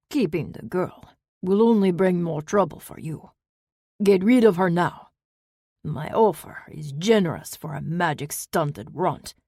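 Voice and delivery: put-on loud, contemptuous Eastern European accent; threatening buyer. A narrator's reading. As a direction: push her to get rid of the girl, scornfully. Bane threatens the aunt.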